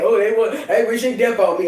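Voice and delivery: loud, strained mock whine; mocking scared enemies. Mocking enemies who claim others wish death on them, loud mock whine. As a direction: parody their fearful complaint. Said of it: "nerved up and scared".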